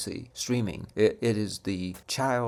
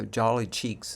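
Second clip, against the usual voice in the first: ordinary talk, nothing marked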